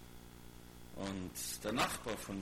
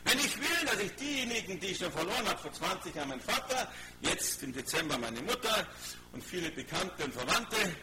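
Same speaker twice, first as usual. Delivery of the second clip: raised, insistent sermon voice; preacher driving the point home, pitched up; forceful preaching; 7 semitones higher, 3 dB louder than usual. Real, not acted. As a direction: press the question on the congregation. Preacher presses the congregation.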